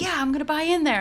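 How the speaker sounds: high-pitched eager outburst; mock-eager, pitched well up; imitated excitement. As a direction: replay your own giddy first reaction. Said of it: "I got super excited about it".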